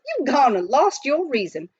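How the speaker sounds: lightly voiced grumpy old miner; scolding miner. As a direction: warn him he's doomed. Billy scolds.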